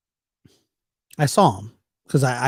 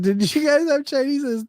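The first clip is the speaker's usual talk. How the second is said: puzzled question; 13 semitones higher than usual. Retold or imitated. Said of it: "I said".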